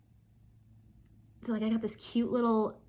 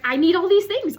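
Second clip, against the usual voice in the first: louder, higher happy outburst; real excitement; 7 semitones higher, 11 dB louder than usual. Real, not acted. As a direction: gush about the gifts. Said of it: "I'm so excited".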